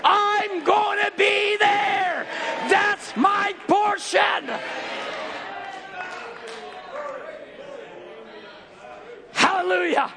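high, shouted preaching; preaching at full force. Acted. Preacher rallying the congregation, shouting. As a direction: drive it home, shouting.

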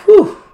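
high, loud whew of relief; relieved whew. Retold or imitated. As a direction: let out a big mock "whew". Imitates child's relief at wicked parents.

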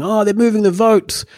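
high-pitched, alarmed crowd cry; mock crowd yell. Podcaster retelling a video of a crowd, mock yelling. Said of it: "this crowd yelling".

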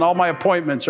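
ordinary talk

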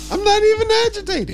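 squeaky protest, not agitated; high-pitched denial. Real, not acted. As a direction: voice jumps way up. Denying anger, high voice.

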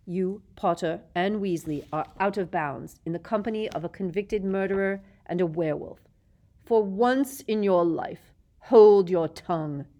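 cold, contemptuous teacher; Snape voice. A narrator's reading.

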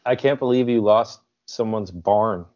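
ordinary talk

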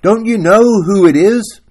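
high, loud pleading question; anguished cry. Acted. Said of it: "he cried in anguish".

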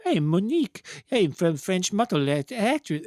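imitation of an actress botching a French accent; terrible French accent. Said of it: "she's just terrible at accents".

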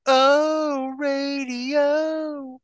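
high, falsetto "Oh, radio"; teasing high-pitched imitation; mock squeal. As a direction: squeak it like an excited fan. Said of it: "you were gonna go".

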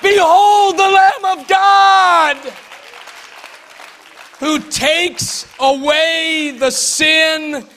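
high, loud, ringing preacher's shout; full-force preaching. Acted. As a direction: stir the church up to shouting.

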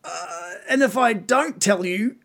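high, hesitant creature voice; reluctant bugbear. Acted. Captive bugbear fearing death if he talks, reluctant.

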